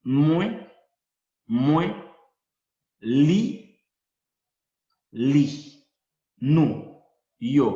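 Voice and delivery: demostrar "mue", "li", "nu" con claridad; Haitian Creole pronouns. Shown as a demonstration. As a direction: pronunciar cada pronombre criollo aparte y fuerte. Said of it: "lo más utilizado que son". (pronunciación lenta y marcada de "mue" "li" "nu")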